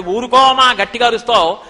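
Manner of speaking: loud, insistent mock scolding; forceful retold indignation, pushed out without letting up; mock angry. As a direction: play a cross child bluffing loudly. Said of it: "కోపంగా కనుబొమ్మలు ముడేసి అన్నాడు".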